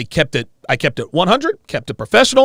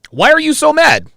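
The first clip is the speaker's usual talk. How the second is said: high, loud mocking mimic; mocking high quote; 10 semitones higher, 7 dB louder than usual. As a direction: parody her question way up high. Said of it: "quoting her again".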